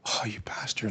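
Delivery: breathless whispered groan of defeat; deflated whisper. Retold or imitated. Fooled friend learns the ticket is fake, deflated whisper. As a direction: hiss it out, crushed and beaten. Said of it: "he was so deflated".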